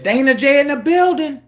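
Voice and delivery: mock livestream hype shout-out; host's shout-out. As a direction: announce him like a hype host.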